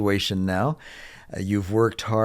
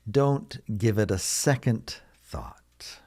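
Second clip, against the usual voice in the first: slow model repeat; slower than usual. Shown as a demonstration. Teacher repeats phrase slowly for learners.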